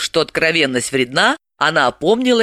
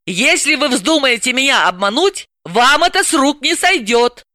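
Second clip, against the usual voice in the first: a lightly raised woman's voice, warning; Dalila's voice; 8 semitones higher than usual. Acted. Dalila warning Grablin not to cheat her, higher stern voice. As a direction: voice Dalila higher, firmly threatening.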